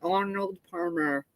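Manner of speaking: "Arnold Palmer" said slowly and softly; careful slow pronunciation. Shown as a demonstration. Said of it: "I can never say it right".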